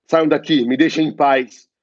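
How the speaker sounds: loud, desperate cry to be left alone; character lashing out. Acted. Patient yelling at unseen tormentors, frantic and loud. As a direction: drive them away, frantic and loud.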